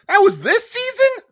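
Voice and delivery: high, loud squeal of disbelief on season; shocked disbelief voice. Shown as a demonstration. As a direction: squeak it out, as if you can't believe it. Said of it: "do 'em really quick".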